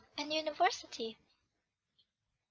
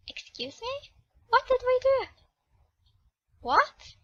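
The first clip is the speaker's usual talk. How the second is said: high, offended excuse me; indignant disbelief; 9 semitones higher, 5 dB louder than usual. Acted. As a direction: squeak in outraged surprise. Girl indignant at insult.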